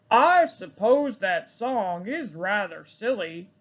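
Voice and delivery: a hint of the farmer; lightly voiced farmer musing. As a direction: farmer admitting the song is silly. Farmer speaks.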